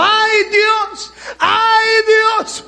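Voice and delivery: high, loud wailing ¡Ay Dios!, crying out; loud, high acted cry of dying fear; terrified cry. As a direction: scream to God as death comes.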